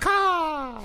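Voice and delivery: high loud crow cry; crow caw shout. Pretend. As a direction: screech like a crow. Shouts a bird call for theme.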